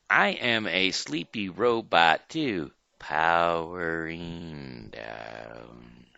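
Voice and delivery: stretching out to a very slow stop; robot powering down. Shown as a demonstration. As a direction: run down like a drained battery. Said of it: "We will show you first".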